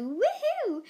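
high, slow cheering woohoo; excited woohoo. Real, not acted. To listeners about the podcast being on Spotify, excited cheering. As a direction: cheer it out with delight.

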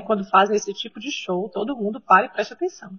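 normal talking voice

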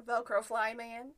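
laughing through the words. Real, not acted. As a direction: chuckle out the nickname. Laughing at nickname.

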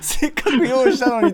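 high-pitched sulky complaint; whiny complaint. Retold or imitated. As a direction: sulk like the effort was wasted. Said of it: "とか言って".

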